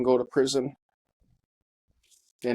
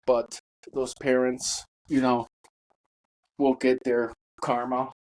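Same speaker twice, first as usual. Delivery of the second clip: ordinary talk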